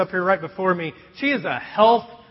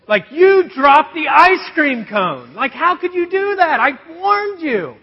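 higher, louder incredulous scolding; indignant rant; 7 semitones higher, 5 dB louder than usual. Retold or imitated. Dad silently fuming at daughter, higher and louder. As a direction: rant at a kid who ignored the warning.

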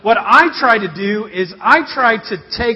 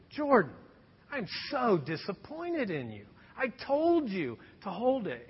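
disappointed scolding; 12 dB quieter than usual. Retold or imitated. Dad scolding daughter coldly.

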